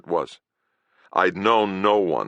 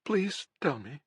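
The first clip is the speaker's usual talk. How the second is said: high, softer pleading whimper; whimpering plea; 12 semitones higher, 5 dB quieter than usual. Acted. Dying girl begging the healer for the truth, high and soft. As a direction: beg quietly in a small high voice. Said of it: "she whimpered".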